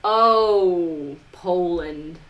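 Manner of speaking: normal talking voice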